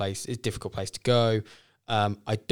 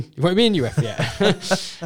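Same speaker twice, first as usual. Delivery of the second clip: laughing; 8 semitones higher, 6 dB louder than usual. Real, not acted. Joke about new player.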